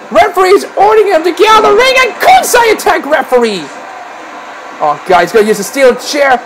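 ordinary delivery

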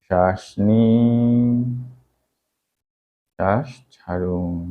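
drawn-out, slow breathing instructions; slow breathing cues. Acted. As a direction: stretch each cue out slowly.

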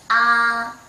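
Ah long, loud and kept level; high level first tone. Shown as a demonstration. Teacher correcting student's first tone, level loud Ah. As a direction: hold Ah out on one unbending pitch. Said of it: "Keep flight".

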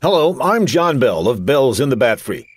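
ordinary talk

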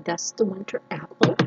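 usual speaking voice

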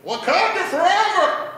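high, loud, drawn-out cheer; mock rallying cry. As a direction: holler it as an overexcited fan.

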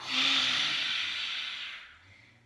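long loud open-mouth exhale; loud breathy lion's breath exhale. Shown as a demonstration. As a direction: push the breath out loudly, tongue out. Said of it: "Lion's breath".

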